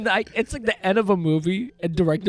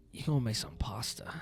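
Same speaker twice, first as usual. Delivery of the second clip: whispered aside; hushed joking whisper; 5 semitones lower, 8 dB quieter than usual. Pretend. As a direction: breathe it out under your breath. Mock suggestion whispered.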